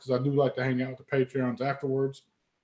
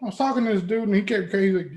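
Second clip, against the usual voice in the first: ordinary talk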